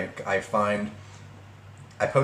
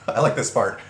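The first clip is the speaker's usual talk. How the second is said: ordinary delivery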